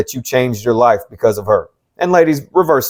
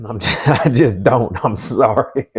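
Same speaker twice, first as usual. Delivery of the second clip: laughing; slower than usual. Real, not acted. Laughs at his own choking up.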